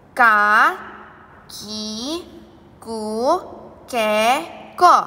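each syllable slow and loud; over enunciating. Shown as a demonstration. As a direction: spell out ka, ki, ku, ke, ko syllable by syllable. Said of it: "yuk ikuti Bu Guru ya".

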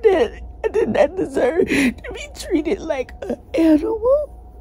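sobbing, pitch cracking upward; real sobbing. Real, not acted. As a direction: voice breaks high into sobs. Breaks down while telling abuse.

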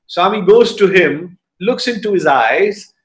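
ordinary talk

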